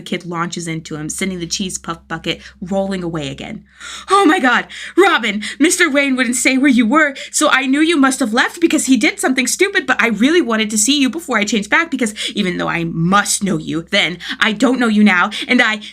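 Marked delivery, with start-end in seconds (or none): 4.1-15.8 high, loud, tumbling out fast; a thrilled boy who can't stop talking; rushed excited kid. A narrator's reading. Tim gushing to Jason, excited and rushed. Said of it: "Tim is talking too quickly to follow".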